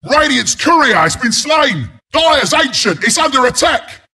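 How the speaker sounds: high-pitched announcer's alarm call; urgent alert. Acted. Announcer warning team of an attack, urgent alert.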